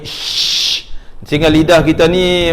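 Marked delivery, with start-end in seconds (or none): 0.0-0.8 hushing air over an arched tongue; sustained shh. Shown as a demonstration. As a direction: demonstrate the sh friction. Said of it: "Lidah tu melengkung naik ke langit". (desis angin "sy")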